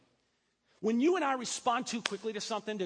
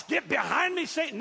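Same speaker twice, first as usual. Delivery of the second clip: higher, louder mock rebuke; mock shout at boss; 5 semitones higher, 7 dB louder than usual. Pastor mocks telling his boss off, loud and high. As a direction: bark it at the boss, hammed-up. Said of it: "Your boss".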